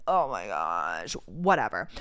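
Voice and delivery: very low, slow exasperated groan; fed up. Retold or imitated. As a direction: drop the voice right down and drag it out, dismissively. Retold fed-up reaction to the company.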